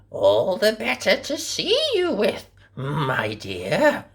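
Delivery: high, sly fake granny; wolf as grandma. A narrator's reading. Disguised wolf answering the girl, sly granny voice. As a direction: answer sweetly with a hidden bite. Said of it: "said the wolf".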